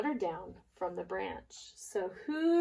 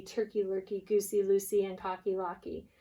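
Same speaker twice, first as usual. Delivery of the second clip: ordinary delivery, nothing marked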